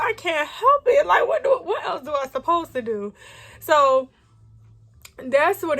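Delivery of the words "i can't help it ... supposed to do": high, crying shout of helplessness; tearful outburst. Retold or imitated. Answering her mom on the phone, tearful crying shout. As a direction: let it break out through tears.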